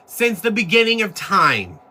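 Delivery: pitched high and pressed, mocking her own bump bit; high ranting. Real, not acted. Mocking her own catchphrase to the critics, high and heated. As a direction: keep the tirade at full steam.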